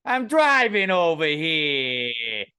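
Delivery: put-on slow, loud New York tough-guy accent; mock East Coast accent. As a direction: draw it out like a Brooklyn driver. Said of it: "what accent that one was supposed to be".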